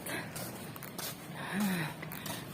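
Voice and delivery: long, soft exhale; winded sigh. Real, not acted. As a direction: let out a tired, airy breath. Winded at end of vlog.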